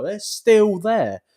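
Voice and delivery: drawn out slowly in disbelief; slow amazed drawl, pitch up high; stunned disbelief. Retold or imitated. Seeing the same lads years later, stretched out in amazement. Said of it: "I was like".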